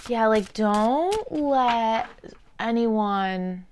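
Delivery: very slow, drawn-out words; odd slow voice. Real, not acted. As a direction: stretch every word out strangely. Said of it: "I don't know why I'm talking like this".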